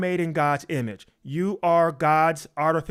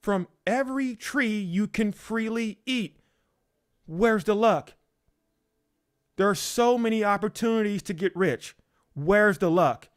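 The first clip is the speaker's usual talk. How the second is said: higher, emphatic preaching; preaching at full force; 4 semitones higher than usual. Real, not acted. Preacher rebuking belief in luck, emphatic preaching. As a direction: drive the point home from the pulpit.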